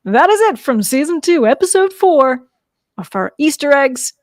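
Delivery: reporter sign-off. Pretend. Host hands back to the studio, mock reporter sign-off. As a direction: hand back like a hammy field reporter.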